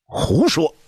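sharp high cry of "nonsense"; angry outburst. A narrator's reading. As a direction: cut him off in fury. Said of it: "太皇太后打断了石见的奏议".

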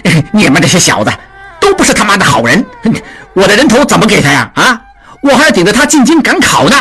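语速快、音调高的恼火抱怨; 又气又急地嚷着要走. A narrator's reading. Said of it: "达庆恼怒道". (达庆被众人逼住，恼怒地嚷)

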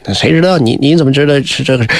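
ordinary delivery

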